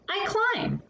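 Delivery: ordinary delivery, nothing marked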